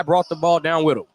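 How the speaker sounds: ordinary talk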